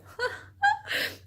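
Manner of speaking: a real high-pitched burst of giggling; high giggle. Real, not acted. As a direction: crack up at her own slip. Laughs after misreading a word.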